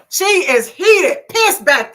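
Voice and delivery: high, loud and slow; drawing out her outrage; heated emphasis. Real, not acted. Excited retelling of anger.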